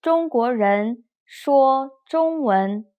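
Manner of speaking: slow, carefully toned Mandarin sentence; slow phrase-by-phrase drill; read-after-me model. Shown as a demonstration. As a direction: model it slowly for learners to repeat. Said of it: "Please read after me".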